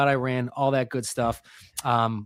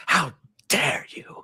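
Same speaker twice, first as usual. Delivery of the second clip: slow, whispered tearful outrage; Greta Thunberg; 3 semitones higher, slower than usual. Retold or imitated. Host imitating an activist scolding world leaders, whispered and tearful. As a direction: channel a trembling, weepy accusation. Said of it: "calling the spirit of Greta Thunberg".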